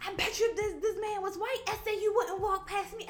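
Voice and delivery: high, loud mock rant; angry cousin. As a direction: throw the accusation at them. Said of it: "he was like".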